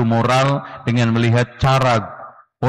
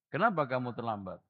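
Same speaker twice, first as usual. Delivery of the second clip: calm quiet call; 3 semitones lower, 14 dB quieter, faster than usual. Shown as a demonstration. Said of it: "panggil aja".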